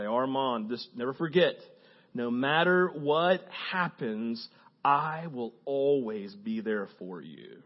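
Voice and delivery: slow, soft fatherly promise; tender father. A narrator's reading. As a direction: reassure the boy gently. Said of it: "his dad Samuel comes to him".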